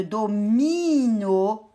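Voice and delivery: "domino" with a long drawn-out "mi"; syllable-by-syllable "domino"; split into syllables. Shown as a demonstration. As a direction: stretch the middle of "domino". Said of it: "je prends la deuxième syllabe de".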